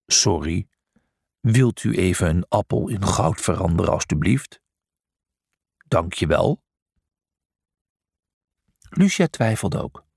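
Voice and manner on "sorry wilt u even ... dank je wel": mock polite. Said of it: "Zeggen we dan".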